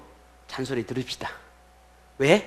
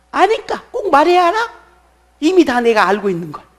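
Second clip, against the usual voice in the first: high, loud indignant retort; mock exasperation; 11 semitones higher, 9 dB louder than usual. Congregation retorting to the pastor's question, mock exasperated. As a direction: play someone fed up at being asked. Voices congregation's reason for not asking.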